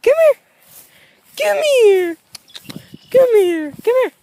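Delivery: high, loud repeated calls; calling the dog. Real, not acted. Owner calling a stray dog over and over, high and loud. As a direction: keep calling until it comes.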